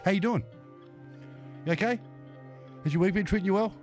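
ordinary talk

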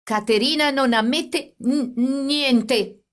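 high, stammering and trembling with fear. A narrator's reading. Brother facing the policeman, stammering in fear. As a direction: catch on the word, trembling. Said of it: "egli disse balbettando".

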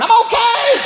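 very high strained yelp; burning race driver. Acted. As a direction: squeal that all is fine while on fire. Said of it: "a guy get out with".

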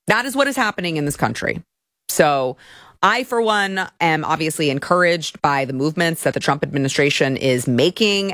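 ordinary talk

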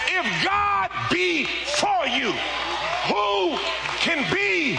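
high, drawn-out preaching shout; full-force preaching. Real, not acted. Preacher declaring God's backing to the church, full-force shout. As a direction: belt it out slowly to the congregation.

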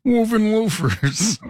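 high, slow, drawn-out mock relish; playful echo. Echoing the co-host's shoe description, playful mockery. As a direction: stretch it out high and silly.

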